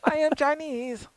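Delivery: high mock Chinese accent, hammed up; a bad Chinese accent, pitched well up. Host playing China bossing TikTok users, in bad Chinese accent. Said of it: "That is, that is a horrible".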